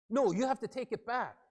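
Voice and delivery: high, loud insistent rebuttal; angry arguing. Acted. Rear-ended driver answering the other driver, angrily. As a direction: refuse the apology as if outraged. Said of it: "angry faces and angry voices".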